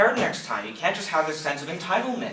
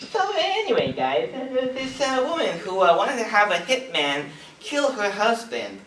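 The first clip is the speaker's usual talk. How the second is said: high, chatty talk-show host voice; Jay Leno impression; 4 semitones higher than usual. Comedian imagining Jay Leno hosting a murder show in a high chatty voice. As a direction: deliver the crime like a late-night monologue, high. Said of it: "that'd be Jay Leno".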